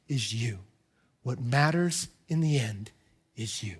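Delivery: softly, like a heartfelt letter; tender, slow and soft. Retold or imitated. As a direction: pastor voicing Paul's letter, soft and tender. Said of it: "And Paul says".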